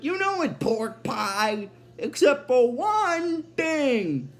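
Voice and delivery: swinging high, stretched-out cartoon delivery; Bender. Robot answering his friend, hammed-up drawn-out voice. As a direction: punch it high, then draw out the ending. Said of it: "And then Bender's like".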